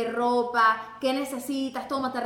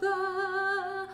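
high, held, stretched-out word; drawn-out announcement; 7 semitones higher, much slower than usual. Shown as a demonstration. Announcing the awkward moment to co-host, drawn-out high note. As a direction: stretch it out high and slow, like a title.

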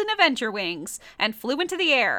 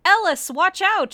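higher, louder warning shout; 7 semitones higher, 5 dB louder than usual. Acted. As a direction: call out the warning. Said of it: "shouted Athena Kells".

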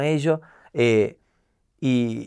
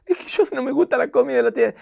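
put-on high, fast pleading kid; whiny child; 12 semitones higher, faster than usual. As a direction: squeak it out like a scared little boy.

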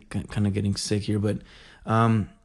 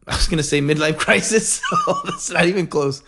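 talking through real laughter, higher; laughing; 6 semitones higher than usual. Real, not acted. Forgetting the word starstruck, laughing at himself. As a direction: crack up at your own mistake.

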